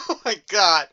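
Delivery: ordinary talk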